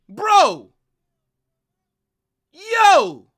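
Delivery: loud, high, sudden shout; disbelieving yell. Real, not acted. Reacting to saves, yelling.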